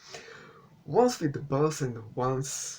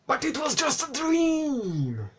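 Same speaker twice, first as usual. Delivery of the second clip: very high squeaky hammed-up reveal; mock-dramatic squeal; 13 semitones higher, 3 dB louder than usual. Reviewer mocking the dream twist, squealed melodrama. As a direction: squeal it, stretching "dream" into a long falling wail. Repeats the mocked twist, exaggerated.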